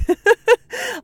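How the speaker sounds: loud high laugh; real loud laugh; burst of laughter. Real, not acted.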